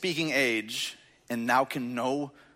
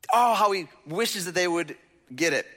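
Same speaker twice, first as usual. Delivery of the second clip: ordinary talk, nothing marked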